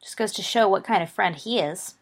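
ordinary delivery

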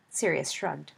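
ordinary delivery, nothing marked

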